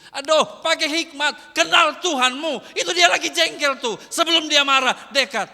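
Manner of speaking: loud, driving sermon delivery with a punch on each kau; pounding out kalau kau like a revival preacher; full-force preaching. Acted. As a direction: preach it hard, building the rhythm.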